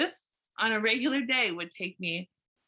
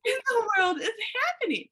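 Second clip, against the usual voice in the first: baffled; 10 semitones higher than usual. Retold or imitated. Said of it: "I'm like".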